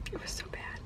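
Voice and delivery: fed-up whisper. Real, not acted. Vlogger ending the rant about the movie, whispered. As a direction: say it on breath alone, totally done. Final exasperated verdict.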